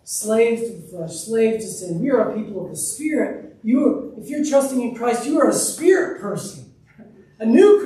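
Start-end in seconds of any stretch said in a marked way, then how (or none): none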